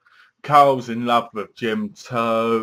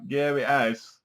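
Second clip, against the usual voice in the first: sarcastic retort; slower than usual. Retold or imitated. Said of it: "you're like".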